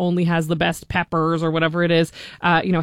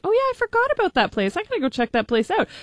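high-pitched remembering aloud; quoting an "oh, right!" moment; sudden realization; 11 semitones higher than usual. Retold or imitated. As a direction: voice your own delighted rediscovery, pitched up. Voices own reaction on rediscovering places.

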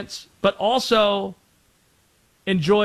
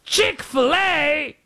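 slow, higher mock British tourist; bad British accent; 11 semitones higher, much slower than usual. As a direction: stretch out Chick-fil-A like a Londoner. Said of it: "My accents are awful".

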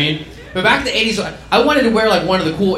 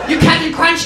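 mocking rant; 5 semitones higher than usual. Dad's mocking rant builds.

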